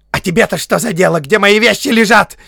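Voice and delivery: fast, high, loud retort; sharp hobbit outburst; angry cry. A narrator's reading. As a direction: yell it indignantly, voice shooting up. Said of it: "вскрикнул он".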